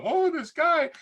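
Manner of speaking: high-pitched moaning, imitating a complainer; mock whining complaint. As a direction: whine like someone who won't let go. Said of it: "whine and bitch and moan and complain".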